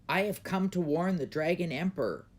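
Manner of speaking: lightly voiced old man with a warning; an old stranger at the gates, firm; old man announcing. A narrator's reading. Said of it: "said the old man".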